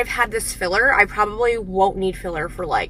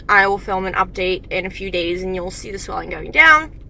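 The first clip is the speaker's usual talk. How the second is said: ordinary talk, nothing marked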